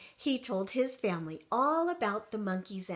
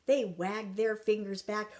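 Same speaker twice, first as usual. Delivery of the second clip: ordinary delivery, nothing marked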